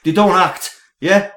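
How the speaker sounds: mock outraged yelling; indignant shout. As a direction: shout him down like a pub bore. Heated mock debate, shouting down.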